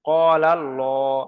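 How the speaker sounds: ক্বালাল্লাহ slowly, with long "qo" and full "llo"; spelling-out demonstration. Shown as a demonstration. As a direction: draw out ক্বালাল্লাহ, L heavy and rounded. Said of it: "উচ্চারণ করে দেখিয়ে দিচ্ছি".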